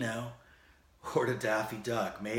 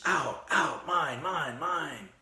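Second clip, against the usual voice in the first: ranting; 5 semitones higher, 4 dB louder, much slower than usual. Retold or imitated. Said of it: "he continues".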